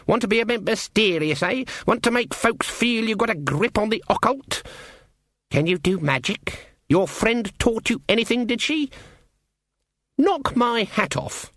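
put-on high, needling old-witch voice; challenging Granny witch. Granny testing a girl who wants magic, challenging. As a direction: grill the girl, pitched well up.

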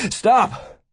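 high, sudden shout; urgent high yell. A narrator's reading. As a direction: shout to halt someone walking away. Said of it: "Alan shouted as Mavis turned away".

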